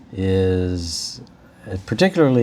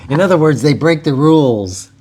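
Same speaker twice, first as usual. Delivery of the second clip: loud, higher-pitched, said through laughter; laughing; 6 semitones higher, 5 dB louder than usual. Real, not acted. Host explains own joke about guests needing parents, laughing.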